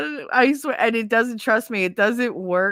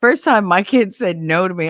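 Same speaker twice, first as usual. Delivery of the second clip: ordinary delivery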